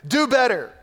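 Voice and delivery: loud, high jeering; hammed-up bystander's yell; mock heckling. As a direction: shout useless criticism from the sidelines. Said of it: "from the sidelines and throwing rocks at them and saying".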